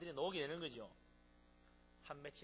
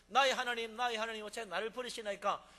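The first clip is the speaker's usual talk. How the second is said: anguished cry; 5 semitones higher, 10 dB louder than usual. Acted. Jesus cries out on cross.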